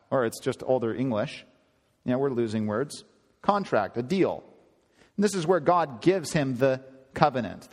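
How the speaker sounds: ordinary talk, nothing marked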